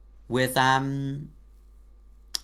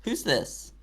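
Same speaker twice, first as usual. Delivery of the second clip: surprised; 8 semitones higher, much slower than usual. Acted. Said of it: "Azrael just sort of spins round".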